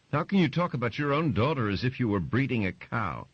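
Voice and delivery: high, loud, indignant demand; outraged mother. Acted. Mother outraged at husband's talk of their daughter, high and indignant. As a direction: throw the question at him in disgust.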